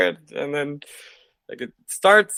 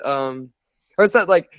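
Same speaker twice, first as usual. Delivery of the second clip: ordinary delivery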